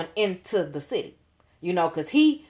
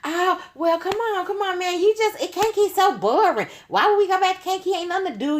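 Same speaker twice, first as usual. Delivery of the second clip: put-on high, coaxing girlfriend voice; pleading friend; 12 semitones higher than usual. Friend coaxing the narrator to skip Kankakee, high coaxing whine. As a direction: wheedle like a bored friend begging. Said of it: "So here she go".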